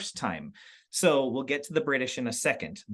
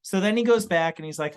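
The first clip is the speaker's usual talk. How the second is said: ordinary delivery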